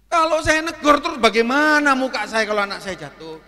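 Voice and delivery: tinggi dan lantang; seruan malu. Real, not acted. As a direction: berseru heboh membayangkan malu. Seruan membayangkan rasa malunya. (seruan lantang penuh rasa malu)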